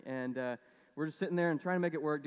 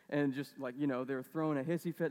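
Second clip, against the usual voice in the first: usual speaking voice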